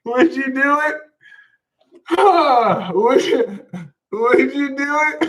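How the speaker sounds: high, giggling disbelief; laughing question. Real, not acted. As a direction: ask it while cracking up. Laughing at the idea.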